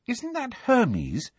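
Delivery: high, slow, astonished question; amazed. A narrator's reading. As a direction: gasp it out in wonder. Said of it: "sounding amazed".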